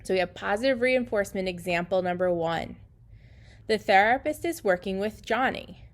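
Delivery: usual speaking voice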